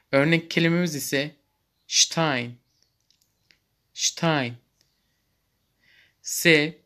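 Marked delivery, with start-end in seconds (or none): 1.9-4.6 Stein said shtyne; German st sound. Shown as a demonstration. Teacher demonstrating German st, carefully. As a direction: start Stein with sht. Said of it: "şıt diye okunmaktadır".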